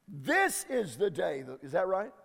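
usual speaking voice

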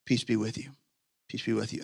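soft and low, forgiving; tender blessing. Acted. Jesus forgiving the disciples who ran, soft and tender. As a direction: breathe it out like a blessing.

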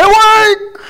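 high, loud burst of awake; sudden shout. Retold or imitated. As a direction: yell it into the kitchen for a laugh. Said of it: "run in there and say".

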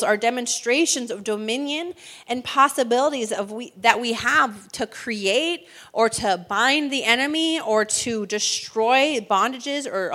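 ordinary talk, nothing marked

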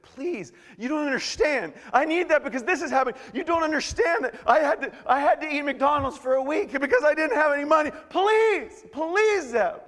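loud, high-pitched hammed-up pleading; desperate begging. Preacher acting out begging Zeb for money, desperate. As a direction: beg frantically, drawing out each "please". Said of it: "I enter into a begging relationship".

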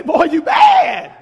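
slow, loud, high admiring call; admiring shout. Retold or imitated. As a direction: call it out like a proud wife. Wife admiring in preacher's story.

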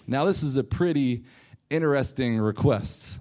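ordinary talk

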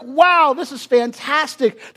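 high, loud, thrilled exclamation; excited crowd. Retold or imitated. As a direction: gush like an amazed townsman. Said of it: "They were so excited".

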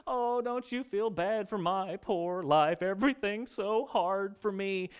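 slow, drawn-out self-pitying moan; milk every word of the pity; whiny complainer. Retold or imitated. Preacher imitates a gloomy complainer, slow and whiny.

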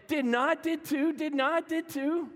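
put-on high, loud childish squabble; bickering kids. Adam and Eve arguing like kids, high and childish. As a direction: trade it back and forth like siblings. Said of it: "Adam said".

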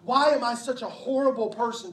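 rising into a desperate outburst of self-blame; the inner voice of condemnation spilling over into a cry; anguished self-accusation. Retold or imitated. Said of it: "feeling of".

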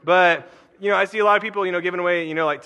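usual speaking voice

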